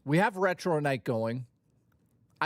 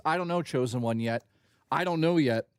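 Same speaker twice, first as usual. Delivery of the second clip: ordinary talk, nothing marked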